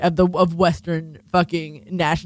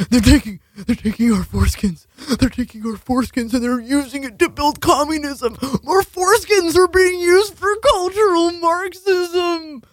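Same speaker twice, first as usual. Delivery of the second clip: mock wailing activist; 8 semitones higher than usual. Host parodying foreskin activists, rising mock wail. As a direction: ham up an anguished wail. Mocking anti-circumcision activists.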